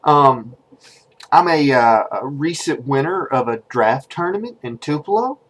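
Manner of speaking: low, quiet, halting introduction; hesitant and awkward, softly spoken with ums; nervous. Acted. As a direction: stammer shyly into a dating video. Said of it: "so I'm nervous".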